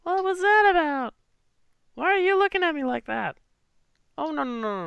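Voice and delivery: put-on high, startled young woman; flustered girl. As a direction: sound caught off guard. Voicing Clover.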